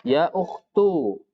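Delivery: ukhtu ending on -tu, slow and careful; vocative ukhtu. Shown as a demonstration. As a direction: say ya ukhtu slowly, stressing ukhtu. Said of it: "berarti bacanya bagaimana?". (ukhtu diucapkan pelan dengan akhiran -tu)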